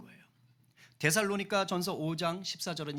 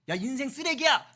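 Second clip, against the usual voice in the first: loud, raised insult; mock cursing; 6 semitones higher, 5 dB louder than usual. Preacher acting out cursing at a lazy person, loud scolding. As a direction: hurl it as an acted insult. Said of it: "라고 욕하는 것이 아니라".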